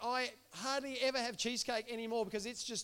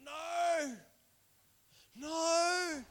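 pleading no; 3 semitones higher than usual. Retold or imitated. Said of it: "And Moses was like".

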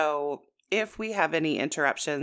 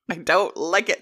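mock hostile; 4 dB louder than usual. Real, not acted. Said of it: "I'm angry".

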